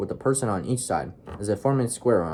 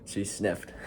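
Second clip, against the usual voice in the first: plain reading voice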